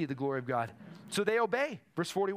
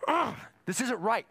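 higher, indignant grunt into the line; mock-angry outburst, pitched up; mad horse snort; 6 semitones higher, 4 dB louder than usual. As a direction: act out anger with an exasperated "ugh". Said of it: "He's mad. Like a horse getting ready".

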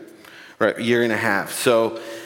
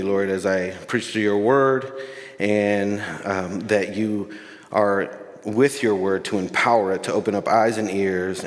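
prayer; 3 semitones lower than usual. Acted.

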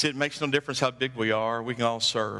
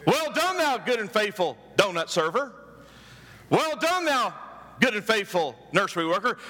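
high rising Well done, repeated in list; lightly hammed-up divine greeting; playful higher calls; mock heavenly praise; 7 semitones higher than usual. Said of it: "You're not gonna hear".

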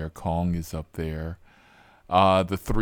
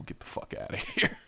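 laughing dismissal; 6 dB quieter, faster than usual. Retold or imitated. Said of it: "and I'm like".

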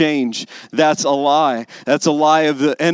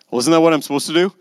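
quick, put-on voice of an indignant Moses; Moses protesting; much faster than usual. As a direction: fire it back fast as a baffled Moses. Preacher voices Moses answering God.

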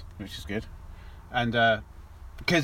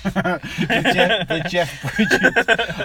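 laughing; 3 semitones higher, 4 dB louder, much slower than usual. Real, not acted. Laughing over the pun.

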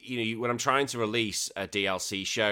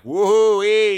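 loud, high, hyped-up presenter greeting; presenter's voice; 10 semitones higher, 12 dB louder than usual. Shown as a demonstration. Podcaster showing listeners the presenter's voice, loud and hyped. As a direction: blast it out like a host opening the show. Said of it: "you've gotta have that presenter's voice".

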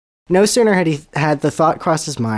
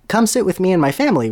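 quick, eager and bright; lightly voiced eager boy; eager offer; 6 semitones higher, faster than usual. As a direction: rush the invite like a kid. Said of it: "Ronald offered eagerly".

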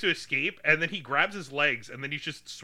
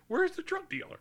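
mock clueless; 13 semitones higher, 5 dB quieter than usual. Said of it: "he's like".

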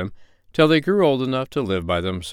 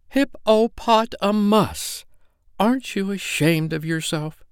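high, slow, drawn-out scolding of the full name; scolding mother dog; 11 semitones higher, slower than usual. A narrator's reading. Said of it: "when he was naughty she called him".

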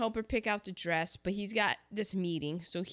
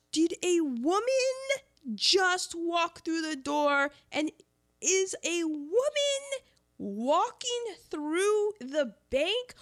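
high, loud, drawn-out mock panic; hammed-up scandalized gasping disbelief; freaking out; 8 semitones higher, 4 dB louder than usual. Bankers aghast at a woman in the bank, mock freak-out. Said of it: "everyone is freaking out in their minds".

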